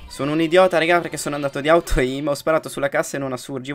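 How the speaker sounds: loud, high self-scolding outburst; frustrated yell. Real, not acted. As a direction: yell at yourself for the blunder. Gamer yells after a failed play. (urlo frustrato contro se stesso)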